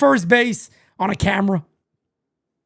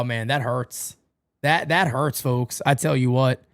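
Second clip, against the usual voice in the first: ordinary talk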